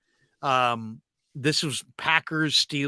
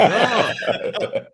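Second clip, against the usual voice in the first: a laughing, raised oh; smirking oh at rival fans' pain; smug laugh; 6 semitones higher, 4 dB louder than usual. Retold or imitated. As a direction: gloat through a laugh. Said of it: "I'm kinda smiling".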